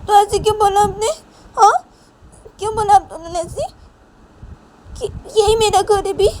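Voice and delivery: high, loud indignant questioning; acted hurt outburst at the parents; aggrieved demand. Daughter demands why they said that.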